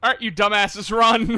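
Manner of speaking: loud, high taunting yell; gleeful shout. Real, not acted. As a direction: yell at them with glee. Yelling at enemies, laughing.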